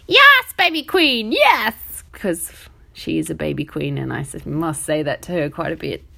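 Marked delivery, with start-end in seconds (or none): 0.1-1.7 much higher, louder and slower, a gushing mock voice; over-the-top sing-song mock praise; daughter's impression of her. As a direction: ham up a kid imitating her mum's praise. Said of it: "she was like".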